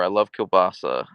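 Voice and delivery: ordinary delivery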